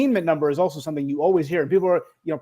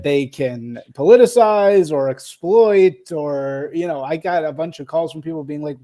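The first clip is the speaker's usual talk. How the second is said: ordinary talk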